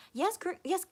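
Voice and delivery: ordinary delivery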